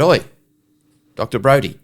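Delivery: retold call to a doctor walking past. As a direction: call his name out loud to get his attention. Calling out to the doctor.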